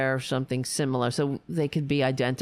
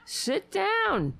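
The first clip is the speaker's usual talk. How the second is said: high-pitched command. Real, not acted. Telling the pet to sit.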